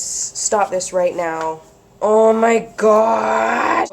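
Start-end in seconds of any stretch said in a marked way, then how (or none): 2.0-3.9 loud, slow, drawn-out oh my God; overwhelmed with delight, stretch it out; excited gasp. Real, not acted. Crafter unboxing happy mail, loud drawn-out excitement.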